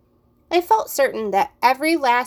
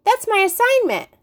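high, louder indignant insistence; boy insists to the teacher it's his homework, high and louder; protesting; 12 semitones higher, 4 dB louder than usual. Acted. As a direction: plead your case fast. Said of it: "I protested".

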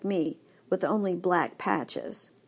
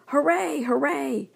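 higher, eager double cheer; 7 semitones higher than usual. A narrator's reading. Dog hears new dogs arriving, excited cheer. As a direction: cheer like a dog who hears friends coming.

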